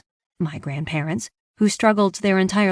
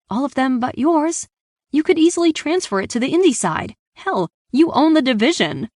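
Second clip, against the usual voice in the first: high, pressing argument; insistent pleading; 7 semitones higher than usual. A narrator's reading. Daughter lobbying dad to transfer her contract, insistent pleading. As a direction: push him hard to give in.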